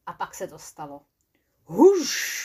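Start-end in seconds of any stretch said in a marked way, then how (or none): 1.6-2.4 loud, higher ghostly huš; a ghost's sudden huš; spooky whoosh. Acted. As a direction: blast it out like a spook. Ghostly noise in the church.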